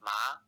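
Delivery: "Ma" rising in pitch; upward-sliding "Ma"; Mandarin second tone. Shown as a demonstration. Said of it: "The word for hemp is".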